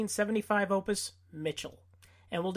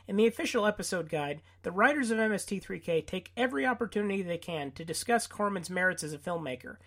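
normal talking voice

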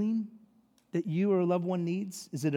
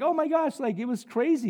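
high-pitched mock astonishment, easing down; comic excited eyewitness report; excited retelling; 8 semitones higher than usual. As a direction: gush like you just saw a miracle. Ahab breathlessly reporting to Jezebel.

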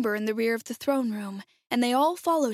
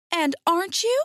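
put-on high, louder girlish question; disbelieving girl; 8 semitones higher, 5 dB louder than usual. Dorothy questioning the supposed wizard, high and disbelieving. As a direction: ask it up high, taken aback.

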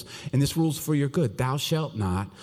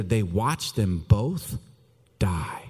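slow whisper; 4 semitones lower, much slower than usual. Acted. Dramatic sermon pause.

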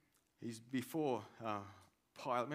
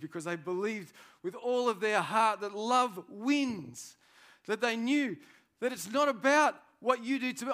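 louder, higher, impassioned sermon push; full-force preaching; 11 semitones higher, 10 dB louder than usual. Acted. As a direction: preach it hard, driving each line home. Preaches about love at full force.

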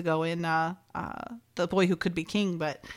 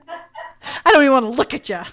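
exasperated dismissal; 4 semitones higher, 8 dB louder than usual. Retold or imitated. Retold boss dismissing his agents.

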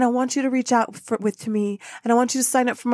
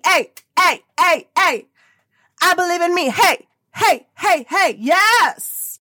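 loud rally cheer, higher; loud excited whoops; 5 semitones higher, 5 dB louder, slower than usual. Real, not acted. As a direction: hype them up like a crowd. Hyping up listeners, cheering.

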